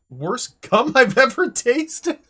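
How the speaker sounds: loud, high, slow words through laughter; laughing. Real, not acted. Streamer reading the game's joke line, laughing loudly. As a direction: crack up while reading. Reading funny game text.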